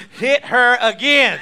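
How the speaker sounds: slow, high, very loud command; exuberant shout. Real, not acted. Preacher ordering another gift for her, exuberant slow shout. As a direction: shout it out between bursts of laughter.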